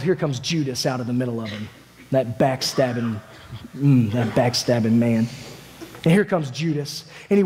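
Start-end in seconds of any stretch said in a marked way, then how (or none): 2.1-5.3 slow, low, disgusted grumble; bitter contempt. Acted. Disciple sees Judas among the armed men, bitterly contemptuous. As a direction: chew slowly on the insult, pitch dropped.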